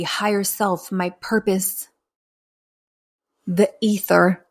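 ordinary talk, nothing marked

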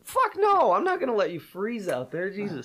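high, loud "fuck no"; emphatic refusal. Retold or imitated. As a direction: shout it down in disbelief.

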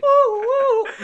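high, loud playful whoop; excited whooping. Real, not acted. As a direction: cheer it out teasingly. Reacting to the story.

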